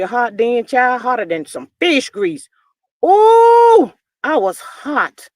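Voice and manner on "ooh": shocked ooh. Real, not acted. Shocked at the reunion guest.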